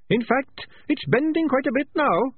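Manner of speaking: small muffled rat voice. Acted. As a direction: speak from behind furniture. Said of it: "which was slightly muffled".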